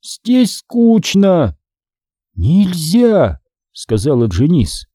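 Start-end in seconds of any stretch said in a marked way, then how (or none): 0.0-1.6 high, very slow whine; complaining zombie son. A narrator's reading. Bored son complains to parents, high slow zombie drawl. 2.3-3.4 higher, drawn-out prohibition; forbidding zombie mother. A narrator's reading. Mother forbids her son, higher zombie drawl.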